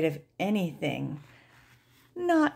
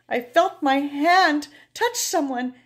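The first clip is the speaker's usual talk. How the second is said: plain reading voice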